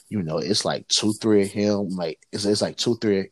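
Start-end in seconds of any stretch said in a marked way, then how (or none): none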